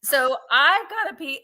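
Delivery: ordinary talk, nothing marked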